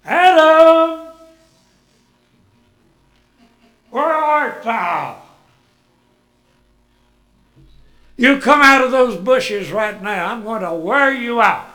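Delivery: loud, high threatening call; mock furious father calling; angry parent. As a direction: threaten a hiding from the bushes. God as angry switch-wielding parent.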